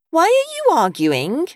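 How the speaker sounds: put-on high, slow little-boy voice; child's drawn-out, questioning whine; young boy. A narrator's reading. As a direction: ask like a puzzled eight-year-old. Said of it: "asked eight-year-old Oliver".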